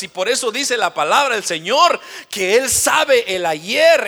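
loud, slow rising sermon cadence; fiery preaching. Acted. Pastor pressing the congregation with questions, fiery preaching. Preacher building to full force.

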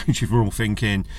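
usual speaking voice

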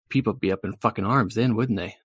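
usual speaking voice

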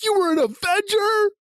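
very high, slow incredulous voice; baffled disbelief. Retold or imitated. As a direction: squeak it out, totally lost. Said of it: "And they're like".

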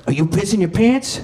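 menacing gangster. Retold or imitated. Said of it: "he's just like".

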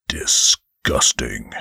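low, slow, contemptuous angel voice; lofty angel, low and slow; disdainful angel. Acted. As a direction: drop low and sneer the word.

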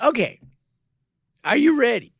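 loud, high taunting question; loud high mocking taunt. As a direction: taunt him like a child. Mocking opponent before rematch.

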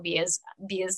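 Australian vowel slipping out on "beers"; quiet Australian-accented aside. Shown as a demonstration. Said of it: "the accent stuck. And here it is".

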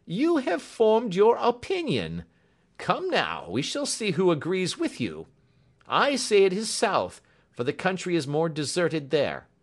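high, loud, animated inspector; lively, high-pitched character exclamations; animated cry. A narrator's reading. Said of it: "cried the inspector".

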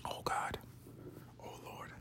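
very soft, voiceless aside; whispered sigh. Real, not acted. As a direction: whisper it to yourself. Weary aside to himself.

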